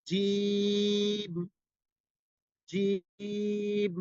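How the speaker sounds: jim held long and sung; drawn-out jim. Shown as a demonstration. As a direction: stretch the letter sound jim. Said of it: "letter drill demo".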